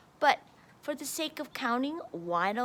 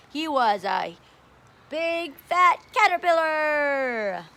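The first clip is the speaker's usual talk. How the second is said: put-on reading to children; 6 dB louder than usual. A narrator's reading. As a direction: read it out big for a class of kids. Reading picture book to kids.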